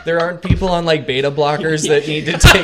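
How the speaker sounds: loud, amused talk through laughter; laughing. Real, not acted. Game master joking with the laughing players, loud and laughing. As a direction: say it loudly while cracking up. Table cracking up at a joke.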